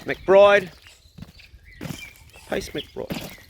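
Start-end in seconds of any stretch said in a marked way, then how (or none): 2.5-3.5 hushed, barely voiced; quiet try-out. Real, not acted. Owner testing a name for his dog, hushed. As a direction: say the name under your breath.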